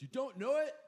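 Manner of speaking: incredulous heckler, pitch shot way up; imitated yelling. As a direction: mimic an outraged yell at contained volume. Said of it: "he just kept yelling".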